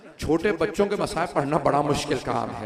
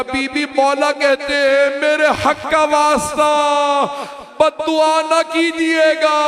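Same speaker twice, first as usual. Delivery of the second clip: much higher, louder and slow, begging cry; climactic wail; 12 semitones higher, 13 dB louder, slower than usual. Acted. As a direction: cry out the plea at the peak. Pleading message, climactic wail. (ऊँची, ज़ोरदार रोती हुई गुहार)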